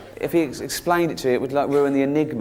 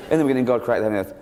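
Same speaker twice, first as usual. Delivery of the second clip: rattled off fast; 5 semitones lower, much faster than usual. Shown as a demonstration. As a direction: race through the verse, half laughing. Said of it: "Okay, here we go".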